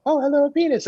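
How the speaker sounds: high-pitched, put-on polite hello; exaggerated high greeting voice; mock introductory greeting. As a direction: act out a dainty, surprised introduction. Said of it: "like, an introductory".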